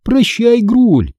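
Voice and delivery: high, gleeful farewell; happy send-off, pitched well up; joyful. A narrator's reading. Girl bidding Груль goodbye, joyful. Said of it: "радостно добавила я".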